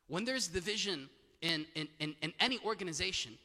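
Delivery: ordinary talk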